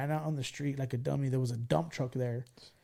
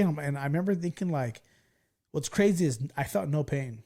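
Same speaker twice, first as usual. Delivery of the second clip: normal talking voice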